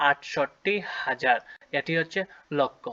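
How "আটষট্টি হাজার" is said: slow careful. Shown as a demonstration. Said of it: "তাই আট হাজার না বলে বলতে হবে".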